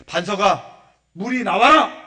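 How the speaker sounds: loud, high command to a rock; spoken command. Acted. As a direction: call it out with full authority. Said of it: "말로 하라는 거잖아요".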